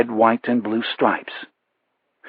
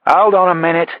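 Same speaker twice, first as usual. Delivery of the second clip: loud, higher call to hold on; shouted; 6 semitones higher, 5 dB louder than usual. A narrator's reading. Producer shouts into both receivers, loud and higher. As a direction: bark it out over the phone lines. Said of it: "he shouted into the mouthpieces".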